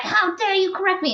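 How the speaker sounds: hammed-up offended squawk; affronted outburst. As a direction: act scandalized, pitch leaping up. Retold outrage at being corrected.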